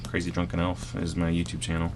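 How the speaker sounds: low, soft, sheepish plug; quietly mumbled self-promotion; ashamed. Real, not acted. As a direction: plug it as if embarrassed. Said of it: "you sounded really ashamed".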